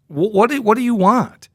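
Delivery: high-pitched mock impatient demand; acted-out exasperated question; retold impatience. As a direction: imitate a busy boss pestered by a caller. Retold impatient commissioner on phone.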